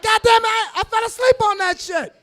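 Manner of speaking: high, loud put-upon complaint; exasperated protest. Retold or imitated. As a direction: insist it wasn't your fault. Exasperated quote about Netflix.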